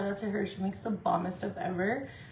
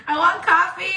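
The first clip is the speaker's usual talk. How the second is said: 1 whiny cry; 9 semitones higher, 11 dB louder than usual. Real, not acted. Whining for coffee.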